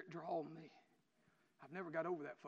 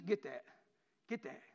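usual speaking voice

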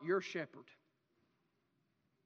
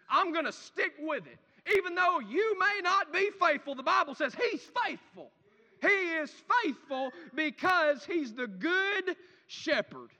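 louder, higher, slowing preacher's climax; fiery preaching; 6 semitones higher, 6 dB louder than usual. Acted. Preacher building to full force.

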